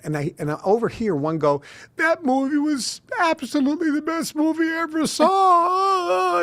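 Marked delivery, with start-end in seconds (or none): 2.0-6.4 high, tearful wail of praise; crying woman. Retold or imitated. A moviegoer gushing through sobs. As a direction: blubber it out through tears. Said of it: "they're both crying".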